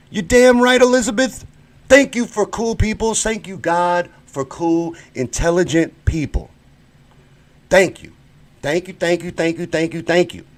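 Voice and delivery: giddy, rapid-fire thank yous; overjoyed thanks to a supportive viewer; high excited thanks. Real, not acted. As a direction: gush with relief and gratitude. Grateful praise to a commenter.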